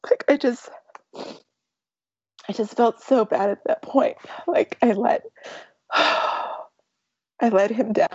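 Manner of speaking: fighting back tears, pitch cracking up; teary and choked, reliving the moment; crying. Real, not acted. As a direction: voice breaking high with real tears. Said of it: "I will start crying when I think about it".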